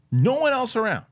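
an outraged rant that jumps high; incredulous outburst. Real, not acted. Incredulous rant about German soldiers.

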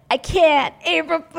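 spring the prank on her; gleeful prank reveal. Retold or imitated. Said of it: "she's like".

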